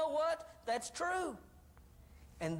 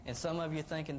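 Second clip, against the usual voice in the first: usual speaking voice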